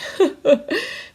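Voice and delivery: a bright rising chuckle; teacher's laugh. A narrator's reading. Teacher laughing at Oscar's joke, light chuckle. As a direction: laugh warmly at the hungry pupil. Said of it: "Missus McNeil laughed".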